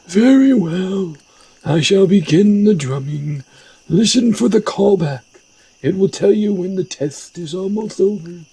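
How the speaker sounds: high, theatrical wise master; high-pitched mystical mentor; shaman teacher character voice. Acted. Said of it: "I like that voice better for this character".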